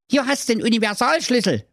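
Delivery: a high pug voice; talking pug. Acted. Pug offering the crowbar, high dog voice. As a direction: speak as the little dog.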